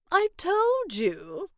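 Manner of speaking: slow, a touch smug; knowing friend. Acted. Butterfly friend gently reminds the girl, slow and smug. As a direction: take your time, you were right. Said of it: "he said as he perched".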